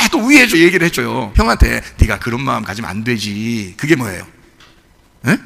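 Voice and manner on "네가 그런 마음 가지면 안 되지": low, soft fatherly rebuke; gentle father. Retold or imitated. Father gently rebukes jealous elder son, low and soft. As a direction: coax the son like a patient father.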